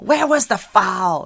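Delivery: mock Jersey yell. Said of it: "the Jersey accent".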